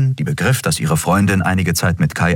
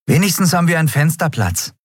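put-on higher, eager boastful kid; Miko, proud boy; 12 semitones higher, faster than usual. A narrator's reading. Miko boasts to the captain about his heroics, higher and eager. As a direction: puff up with pride. Miko's voice.

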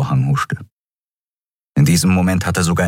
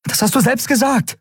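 high accusation, climbing; angry outburst. A narrator's reading. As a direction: burst out accusing him. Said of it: "platzte es aus ihr heraus". (aufgebracht und anklagend)

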